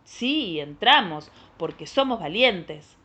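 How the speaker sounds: loud, emphatic swoops on each phrase; proud, punched-out children's reply; bold brave answer. Acted. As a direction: proclaim it with storybook bravado.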